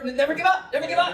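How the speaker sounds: high, rapid-fire pupil imitation; eager kids. Teacher imitates other kids wanting a paper, eager. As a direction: pipe up high, desperate to be picked. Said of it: "the other kids are like".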